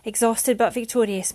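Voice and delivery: ordinary delivery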